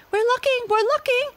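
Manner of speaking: very high tiny monkey voices; squeaky monkeys. A narrator's reading. Six monkeys answer the gorilla, very high and squeaky. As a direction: chatter back nervously. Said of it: "said the six little monkeys".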